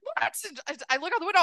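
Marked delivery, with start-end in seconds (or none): 0.0-0.4 high, startled "What?"; surprised. Retold or imitated. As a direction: caught off guard by the shout. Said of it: "I'm like".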